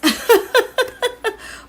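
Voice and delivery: high, loud burst of laughter; real laugh. Real, not acted. Tarot reader laughing at the card just drawn, high laughter.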